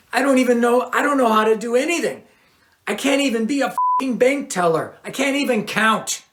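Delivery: panicked voice of a man with no skills; anxious outburst. A narrator's reading. Lear confessing his fears to Stevens, rising panic.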